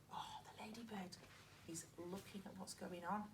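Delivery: soft whisper. Shown as a demonstration. Teacher whispering to the children about the ladybird. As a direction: keep it hushed like a secret. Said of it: "whispering to children".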